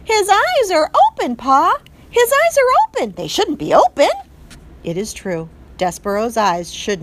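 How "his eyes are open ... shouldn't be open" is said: put-on high, loud alarmed child voice; alarmed brother. A narrator's reading. Brother mouse telling Pa the baby's eyes are open, alarmed. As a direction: cry out in alarm to Pa.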